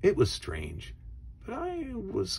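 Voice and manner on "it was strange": usual speaking voice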